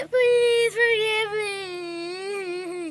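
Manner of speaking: long, high, loud mock wail; begging. Haman on the floor before queen, begging. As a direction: whine it out, stretching the last word. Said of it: "begging at my queen".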